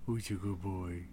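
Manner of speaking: soft, slow cooing at a pet; baby talk to a dog. Acted. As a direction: coo at the good boy. Talking to the creature.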